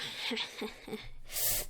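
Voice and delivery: breathy menacing chuckle; villain laughing at Deku. Acted. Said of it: "He's like".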